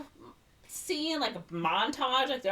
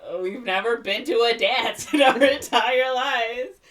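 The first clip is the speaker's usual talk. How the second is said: loud, slow, high whine with laughter; mock woeful teens; 7 semitones higher, 11 dB louder, slower than usual. Teens hug the wall at the dance, mock woeful wail. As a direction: wail it slowly, mocking them.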